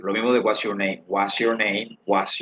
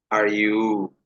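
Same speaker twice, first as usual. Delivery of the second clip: very slow, word-by-word question; slow model question; much slower than usual. Shown as a demonstration. Teacher asks students about marital status, very slowly word by word. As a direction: stretch each word for learners.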